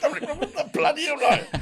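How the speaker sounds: high, laughing imitation of Frank; hammed-up Frank with a laugh; mock Frank voice. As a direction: squeal it out like Frank in disbelief. Mimicking Frank on the phone, laughing.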